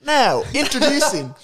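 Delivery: broad Aussie pitchman; hammed-up Australian commercial voice; Australian accent. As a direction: put on an Aussie accent for a mock ad.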